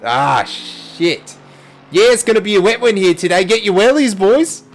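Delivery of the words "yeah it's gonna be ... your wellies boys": mock Aussie commentator. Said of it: "Aussie greatness".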